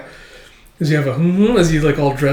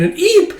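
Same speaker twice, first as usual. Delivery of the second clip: tiny eep; 3 dB louder than usual. Retold or imitated. Squeak of fright.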